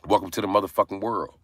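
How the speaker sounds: ordinary talk, nothing marked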